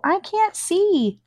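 high, slow little snail; very slow slimy snail voice. Acted. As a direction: drag it out high and tiny. The snail speaks in the story.

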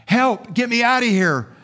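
high, acted cry for help; yelling for help. Clay trapped in the oven, yelling for help. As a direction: call out in panic, pitch jumping up. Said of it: "I yelled and knocked and pounded".

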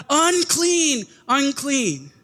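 high calling cry, falling on each word; leper calling out. Retold or imitated. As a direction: call out a warning to keep people away. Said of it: "you had to yell out".

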